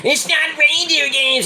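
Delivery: a prissy co-host protesting; imitating Michael Kay. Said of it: "Michael K's like".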